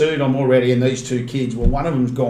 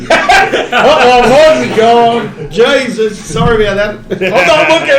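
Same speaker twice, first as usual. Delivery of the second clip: laughing; 9 semitones higher, 10 dB louder, slower than usual. Retold or imitated. Acting the sick kid in helicopter.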